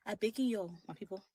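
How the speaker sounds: pleading in Liberian English; soft Liberian plea to her people; Liberian accent. Shown as a demonstration. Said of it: "I'm gonna put in my Liberian accent".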